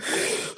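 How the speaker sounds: sharp, quick intake of breath; imitated fright gasp; startled gasp. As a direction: gasp as if a dog just barked. Startled reaction to hearing barks.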